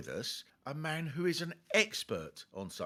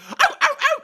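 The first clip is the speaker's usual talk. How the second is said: high, loud yelping cries; mock yelps; 11 dB louder than usual. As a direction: yelp as if something's biting you. Acting the dog's mayhem.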